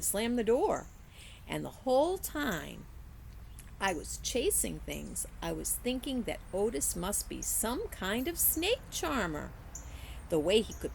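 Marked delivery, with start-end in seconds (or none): none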